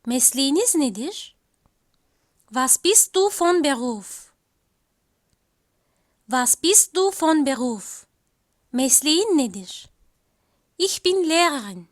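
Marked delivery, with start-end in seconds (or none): none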